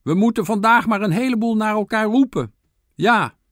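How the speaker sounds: high, loud shout over a fence; calling out. A narrator's reading. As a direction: make the words carry to a friend.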